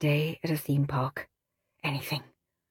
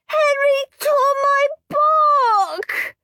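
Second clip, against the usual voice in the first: very high, slow, loud wail; wailing tattle; 7 dB louder, much slower than usual. A narrator's reading. Peter tattling to his mother, wailing. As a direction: wail the complaint.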